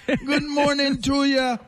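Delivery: put-on high squawky Jamaican-accented parrot. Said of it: "Did he have a Jamaican accent?".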